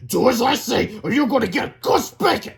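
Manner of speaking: high, loud threat; angry. A narrator's reading. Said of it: "he said angrily".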